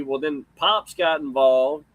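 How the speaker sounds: ordinary talk, nothing marked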